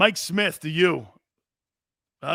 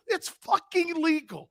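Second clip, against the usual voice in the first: mocking angry coach; 12 semitones higher, slower than usual.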